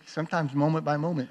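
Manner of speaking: ordinary talk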